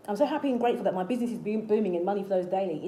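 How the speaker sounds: fast, run-together affirmation in one breath; rapid affirmation demo. Shown as a demonstration. Coach repeating a simple business affirmation, quick and continuous. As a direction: rattle the affirmation off without pausing. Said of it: "an example of an affirmation you can say".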